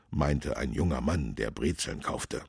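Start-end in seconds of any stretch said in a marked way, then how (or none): none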